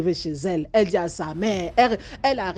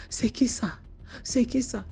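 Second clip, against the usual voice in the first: frightened question; 6 semitones higher than usual. Acted. Woman repeats frightened question.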